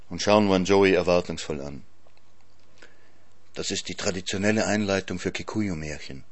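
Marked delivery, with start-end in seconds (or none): 3.5-6.2 soft, hushed explanation; whispered aside. A narrator's reading. Elsa quietly explaining a tradition to the narrator, whispered aside. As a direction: lean in and explain it under the breath. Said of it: "flüstert mir Elsa Greich zu".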